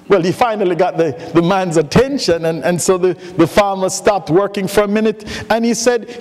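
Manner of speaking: normal talking voice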